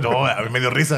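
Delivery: ordinary talk, nothing marked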